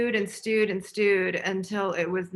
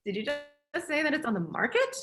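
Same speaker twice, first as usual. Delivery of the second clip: higher-pitched, incredulous question; retold disbelief; 7 semitones higher than usual. Filmmaker hearing the mansion is for sale, mock disbelief. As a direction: imitate a stunned double take, rising at the end. Retold stunned reply to the news.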